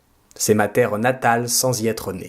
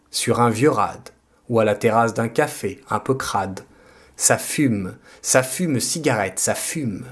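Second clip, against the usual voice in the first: ordinary talk, nothing marked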